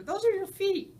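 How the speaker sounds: high, loud amused explaining; lightly voiced character. Ivan showing Ruby her picture, high and loud. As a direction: point out the feet with a lift.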